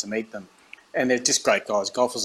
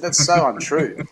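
slow, higher, louder hammed-up denial; teasing Tiger with exaggerated protest; mock protest; 4 semitones higher, 5 dB louder, slower than usual. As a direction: stretch it out in jokey indignation.

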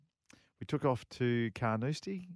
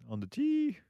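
high, drawn-out first-tee announcer call; [name]'s funny voice; slower than usual. Retold or imitated. Golfer mimics the first-tee starter, funny high voice. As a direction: sing out the last word high and long. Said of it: "with his funny voice".

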